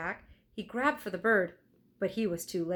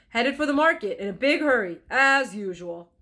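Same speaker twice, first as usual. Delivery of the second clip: gruff blacksmith; 5 semitones higher, 8 dB louder than usual. Acted. Blacksmith answers.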